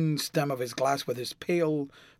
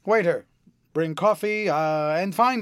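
louder, higher call across a restaurant; 3 semitones higher, 6 dB louder than usual. Acted. Lord Henry calls the waiter for coffee, a loud raised call.

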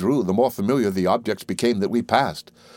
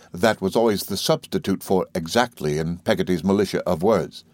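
ordinary delivery, nothing marked

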